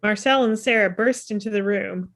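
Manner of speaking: normal talking voice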